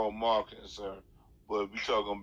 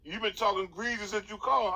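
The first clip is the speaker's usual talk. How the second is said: much higher, heated repeat of the accusation; 10 semitones higher than usual. Acted. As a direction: throw it back at him, pitched way up. Repeating the accusation in a phone argument.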